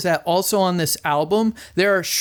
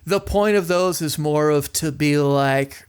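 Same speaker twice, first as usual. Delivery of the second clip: ordinary delivery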